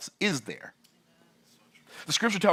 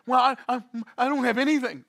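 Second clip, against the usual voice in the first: imitating a complaining listener, higher pitched; mock whiny listener; 9 semitones higher than usual. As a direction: play a doubter who whines that he has nothing. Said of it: "If you think".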